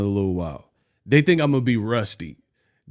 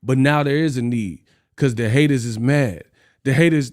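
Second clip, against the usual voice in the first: ordinary talk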